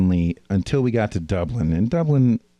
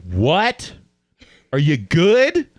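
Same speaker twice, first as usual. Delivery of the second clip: higher-pitched mock Irish accent, questioning; 8 semitones higher, 3 dB louder, much slower than usual. Hotel staff to confused American, mock Irish accent. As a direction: put on an Irish receptionist's challenge. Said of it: "In Irish accent".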